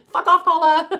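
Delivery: high, loud laughing jab; loud, high laughing dismissal; laughing outburst. Real, not acted. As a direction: burst out laughing as you tell her off.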